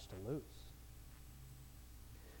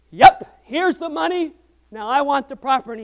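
a much louder, high, gleeful Yep; triumphant shout; 9 semitones higher, 20 dB louder than usual. Retold or imitated. Buyer paying for the old mill, triumphant loud shout. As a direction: slap the money down in triumph. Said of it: "he's like".

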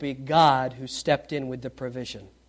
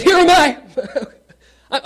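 laughing relief; 9 semitones higher, 6 dB louder, much slower than usual. Acted. Relieved Abraham answers angel.

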